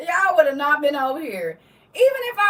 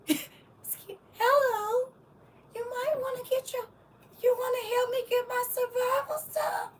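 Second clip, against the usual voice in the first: much higher, soft frail plea; old man voice; 10 semitones higher, 8 dB quieter than usual. Retold or imitated. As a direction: sound like a helpless old man. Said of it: "that's what that voice remind me of".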